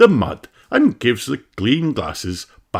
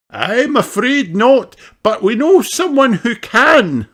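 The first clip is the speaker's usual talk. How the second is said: daddy pony; 14 semitones higher, 5 dB louder than usual. A narrator's reading. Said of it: "replies Doctor Pony".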